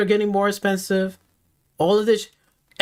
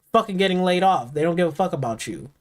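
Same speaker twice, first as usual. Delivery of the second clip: ordinary talk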